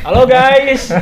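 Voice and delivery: high, bright vlogger hello; YouTuber greeting. Pretend. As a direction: greet the audience like a hyped YouTuber. Greeting viewers YouTuber style. (sapaan ala YouTuber)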